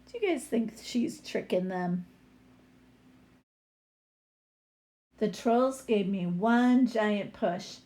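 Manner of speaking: lively picture-book read-aloud; story reading. A narrator's reading. Teacher reading a troll story to her class, lively read-aloud.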